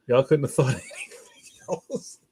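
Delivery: words breaking up into laughter; laughing. Real, not acted. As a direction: crack up halfway through the line. Joking about lame Christmas gifts.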